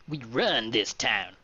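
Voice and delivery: slow, drawn-out gangster boast; Chicago gangster. Acted. Gang man warns the traveler, slow gangster swagger. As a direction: act a gang boss, taking it slowly. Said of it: "Chicago's most notorious work gang".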